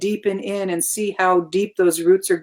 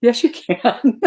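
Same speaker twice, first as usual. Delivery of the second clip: mock encouraging voice; slower than usual. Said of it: "look at and say".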